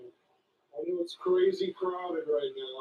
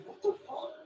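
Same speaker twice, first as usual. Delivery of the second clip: high mock scream; 14 semitones higher, 5 dB quieter than usual. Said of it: "Somebody just screamed".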